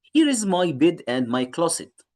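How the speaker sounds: very slow, word by word English; slow reading. Shown as a demonstration. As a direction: read each word slowly for the class. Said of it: "reads dialogue slowly".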